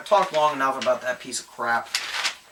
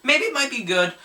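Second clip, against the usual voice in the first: a much higher, hammed-up squeal of hope; mock hopeful; 12 semitones higher than usual. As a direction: mimic naive excitement with a squeaky break. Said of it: "because I thought".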